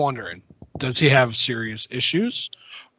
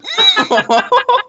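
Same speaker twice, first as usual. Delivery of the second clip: pained laugh; 4 dB louder than usual. Real, not acted. Kicked in the groin, pained laugh.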